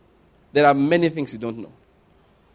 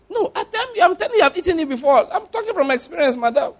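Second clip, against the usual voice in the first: much higher, laughing persuasion; insistent Satan; 12 semitones higher than usual. Retold or imitated. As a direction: talk her into it with a grin.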